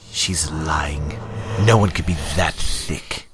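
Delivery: whispered, then loud other-voice sneer; a hissing voice in his head; sneering inner voice. Acted. Leech sneering to Damien inside his head.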